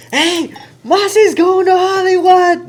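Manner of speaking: excited shout. Retold or imitated. Friend Wes announcing the Hollywood call to the crew, overjoyed shouting. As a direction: yell the big news out to everyone, beaming. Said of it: "he's super happy".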